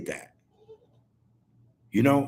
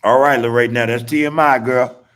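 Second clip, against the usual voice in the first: louder teasing shout to Lorraine; teasing call-out; 7 dB louder than usual. Real, not acted. To Lorraine after her comment, a loud teasing call. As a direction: call her out loudly, laughing.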